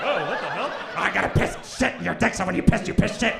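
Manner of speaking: hammed-up disgusted protest; mock shocked boyfriend. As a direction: recoil like a scandalized guy. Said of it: "He'll be like".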